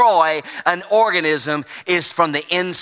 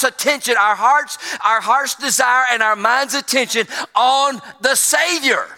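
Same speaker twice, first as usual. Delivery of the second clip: higher-pitched, insistent pulpit delivery; impassioned preaching; 5 semitones higher than usual. Acted. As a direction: preach it with rising urgency, drawing out key words. Preaches at full force.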